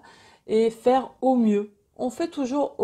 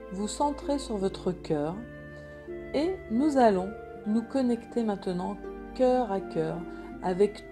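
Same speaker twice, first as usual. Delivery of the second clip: guided meditation; 5 dB quieter than usual. Acted. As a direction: guide them gently into calm.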